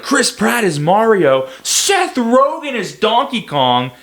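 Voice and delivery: high, drawn-out loud mock outrage; stretched-out incredulous announcement, high and loud; mock outcry. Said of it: "They were like".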